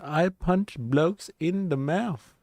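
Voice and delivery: mock Vietnamese accent, high and very slow; Viet accent. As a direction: put on a Vietnamese accent, drawn out. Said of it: "In a Viet accent".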